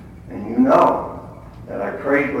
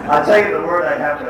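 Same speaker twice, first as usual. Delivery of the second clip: laughing; 10 dB louder, faster than usual. Real, not acted. Laughing after crowd remark.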